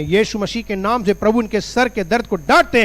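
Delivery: forceful, commanding rebuke in prayer; insistent preacher praying for healing. Real, not acted. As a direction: rebuke the sickness with authority.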